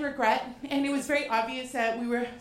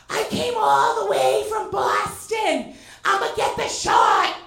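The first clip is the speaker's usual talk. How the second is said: loud, slow, higher declaration; loud bold declaration; 7 semitones higher, 8 dB louder, slower than usual. Retold or imitated. Fan yelling out truck window at [name], loud and slow. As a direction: announce it out the window like a paparazzo. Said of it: "I lean out and I go".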